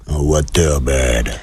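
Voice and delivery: low slow drag voice; deep comic woman voice. Acted. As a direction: drop low and draw it out. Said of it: "La voix".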